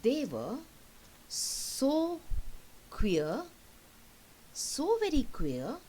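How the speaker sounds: loud, emphatic reading; poem recitation. Acted. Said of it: "the poet has written".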